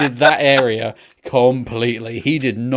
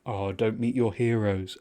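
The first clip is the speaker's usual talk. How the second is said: slow, softened voice of a sage; old wise man; 3 semitones lower, 8 dB quieter, slower than usual. Retold or imitated. Wise old man echoing in a worried fan's head, slow and hushed. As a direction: lightly play an old man giving a quiet warning. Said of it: "like, an old wise man being like".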